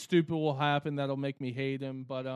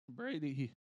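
voice cracking; 10 dB quieter than usual. Real, not acted. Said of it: "my voice just cracked".

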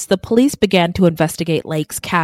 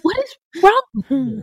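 exasperated disbelief; 10 semitones higher than usual. Real, not acted. Exasperated at Clara Lynn.

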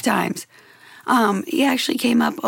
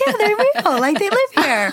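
much higher, wide-eyed disbelief; amazed; 11 semitones higher than usual. Retold or imitated. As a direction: squeal it in shock. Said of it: "And I'm like".

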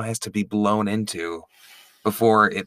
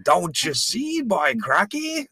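mock bad Australian accent; 12 semitones higher than usual. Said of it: "the level you were coming at it from".

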